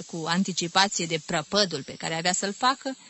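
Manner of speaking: plain reading voice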